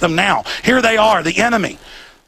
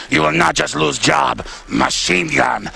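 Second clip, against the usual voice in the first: slow, low mock Russian accent; drawn-out Russian henchman accent; 4 semitones lower, slower than usual. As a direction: threaten them in a heavy put-on Russian accent. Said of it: "That's more like a Russian accent".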